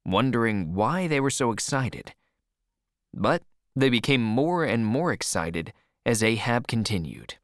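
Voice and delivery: ordinary delivery, nothing marked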